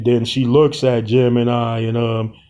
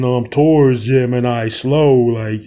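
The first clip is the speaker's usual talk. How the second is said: plain reading voice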